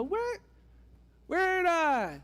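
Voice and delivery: high, drawn-out mock-startled "where"; startled questioning. Danites hearing the Levite's accent, mock-startled. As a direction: blurt it high, as if baffled by a stranger's accent. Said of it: "and they go".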